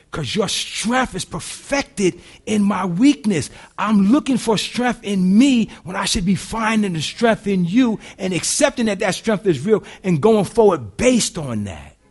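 high, loud, ringing preacher's climax; preaching at full force. Acted. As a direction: drive it home to the congregation.

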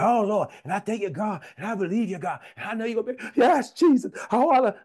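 high-pitched, mock frantic praying; frantic prayer. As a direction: imitate someone praying nonstop, building to loud cries. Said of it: "frantic prayer. I see people who have prayer time and they don't even know how to be quiet".

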